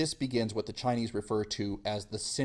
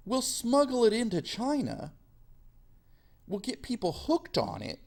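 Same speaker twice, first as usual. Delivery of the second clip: mock scheming colonial voice, pitched higher; scheming British; 10 semitones higher than usual. Teacher voicing British plotting opium smuggling, hammed-up high mock voice. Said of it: "so the British say".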